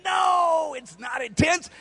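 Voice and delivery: high, drawn-out protesting no; emphatic denial. Retold or imitated. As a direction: playfully wave off the worry. Retold reassurance, contradicting her.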